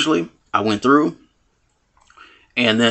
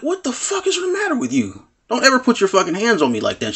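much higher, indignant yell-like retort; retold angry outburst; 10 semitones higher than usual. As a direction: snap back at her, outraged. Confronting the girl who grabbed him.

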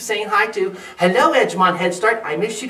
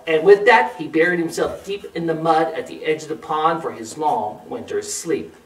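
ordinary delivery, nothing marked

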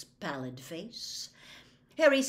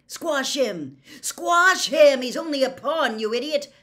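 loud, high, excited shouting; enthusiastic egging on; 8 semitones higher, 14 dB louder than usual. A narrator's reading. Boy cheering on his chess piece, enthusiastic. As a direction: urge it on at full voice, rising. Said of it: "he was egging it on enthusiastically".

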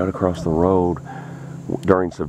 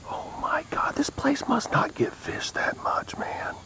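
awed unvoiced whisper; 8 dB quieter than usual. Real, not acted. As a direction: whisper it in disbelief. Whispering over the fish.

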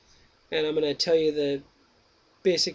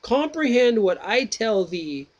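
slow, higher, deliberate reading voice; reading Plato's Meno aloud, slow and raised; slow dialogue reading; 5 semitones higher, 4 dB louder, much slower than usual. Acted. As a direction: read the old text out slowly and carefully.

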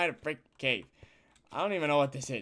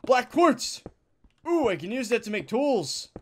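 excited; 6 semitones higher, 5 dB louder than usual. Real, not acted.

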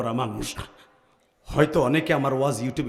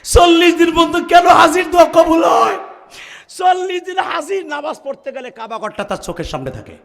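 impassioned preaching; 11 dB louder than usual. Real, not acted. Preacher stirring the crowd about pilgrims, impassioned. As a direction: preach it out high and fervently.

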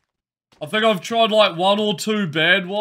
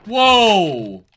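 startled whoa; 4 semitones higher, 8 dB louder than usual. Real, not acted. Sudden shock in game.

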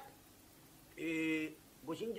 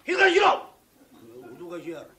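angry shout; 13 dB louder than usual. Acted. Master loses his temper and shouts at the drunk. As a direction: yell him into silence.

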